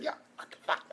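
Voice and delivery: stunned whisper under the breath; hushed shocked aside; whispered disbelief. Retold or imitated. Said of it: "I'm just like".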